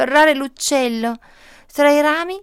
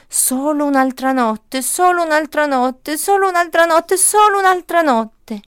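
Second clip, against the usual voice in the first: each repeat climbs higher and louder; rising lullaby repeat; 5 semitones higher, 4 dB louder than usual. Acted. Tree hushing the little seed, climbing higher and louder. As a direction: keep promising, climbing higher each time.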